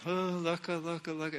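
softly imitated babbled syllables; tentative tongues. New believer feeling syllables in his throat, tentative imitated tongues. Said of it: "little feeling in the back of my throat".

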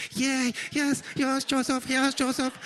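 high-pitched, eager yes-woman voice; Edith. Retold or imitated. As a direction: imitate a flustered sitcom wife hurrying to obey. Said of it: "comes running like Edith, you know, on Archie Bunker".

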